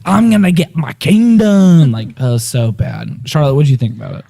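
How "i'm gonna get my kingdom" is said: slow, heavy mock South African drawl; mock South African accent. Mocking the actor from District Nine in a South African accent. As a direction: ham up a South African villain accent, slowly. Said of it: "He's like".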